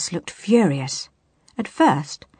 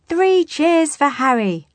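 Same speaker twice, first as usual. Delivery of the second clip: high, loud, drawn-out cheer; cheering cry; 10 semitones higher, 6 dB louder, slower than usual. A narrator's reading. Pirates celebrate their young crewmate, cheering loudly. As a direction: lead a triumphant hurrah. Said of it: "the pirates cried".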